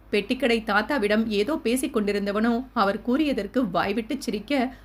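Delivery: ordinary delivery, nothing marked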